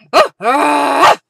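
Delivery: loud mock startled yelp; startled cry. Retelling the dog waking her, loud mock startled yelp. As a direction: imitate being jolted by the barking.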